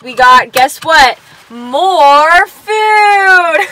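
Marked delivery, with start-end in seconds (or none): 1.5-3.7 loud, higher, triumphant announcement; excited shout. Real, not acted. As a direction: shout it out to the viewers. Announcing dessert to the camera.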